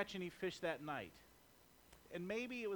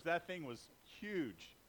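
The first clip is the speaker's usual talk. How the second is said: fisherman tall tale; 3 semitones lower, slower than usual. Acted. Fisherman exaggerating his catch.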